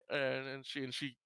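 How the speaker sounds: normal talking voice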